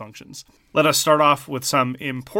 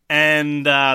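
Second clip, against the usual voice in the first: ordinary delivery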